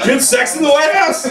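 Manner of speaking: can't believe what you just heard; shocked disbelief. Real, not acted. Comic repeats audience member's answer in high disbelief.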